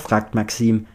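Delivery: usual speaking voice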